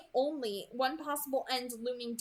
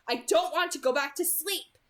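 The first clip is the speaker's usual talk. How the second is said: louder, higher irritated retort; snapped; 5 semitones higher, 8 dB louder than usual. A narrator's reading. Bella refuses to be put to sleep, snapped. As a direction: snap back in protest. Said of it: "I snapped".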